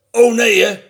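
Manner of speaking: high, loud dismayed call; fox's loud cry of dismay. A narrator's reading. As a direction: exclaim in storybook alarm. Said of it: "riep hij".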